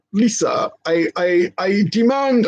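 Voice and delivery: a hint of a grand actor demanding; George Takei voice. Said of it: "I'm doing a George Takei voice".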